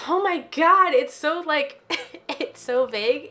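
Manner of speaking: high loud amused disbelief; surprised. Real, not acted. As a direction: react with amused shock. Reaction to vague romance guru.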